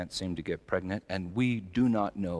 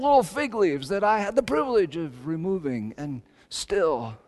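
loud, soaring sermon delivery, each line pushed higher; full-force preaching; 12 semitones higher, 10 dB louder than usual. Acted. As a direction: preach it out to the whole church, building. Impassioned sermon build.

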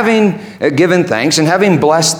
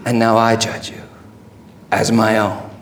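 low, hushed pronouncement; tender verdict; 6 semitones lower, 6 dB quieter than usual. Acted. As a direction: say it low and soft, in spaced phrases. Jesus tenderly claims the couple.

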